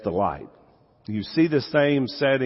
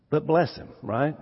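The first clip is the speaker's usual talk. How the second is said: usual speaking voice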